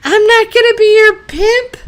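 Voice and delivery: high-pitched mock-indignant retort; hammed-up high protest. Acted indignant retort from a show.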